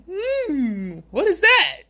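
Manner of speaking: imitating baffled onlookers; mock puzzled stare. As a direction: rise sharply into a shocked squeak. Mocking strangers' reaction to bare face.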